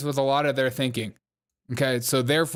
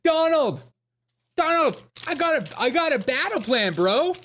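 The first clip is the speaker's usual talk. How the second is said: high-pitched, hammed-up urgent shout-out; mock excitement, pitched way up; mock frantic calling; 12 semitones higher than usual. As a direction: play an over-eager pal calling for attention. Mock plea calling out to Donald.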